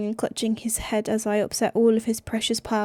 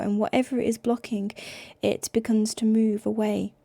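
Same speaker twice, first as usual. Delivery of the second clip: usual speaking voice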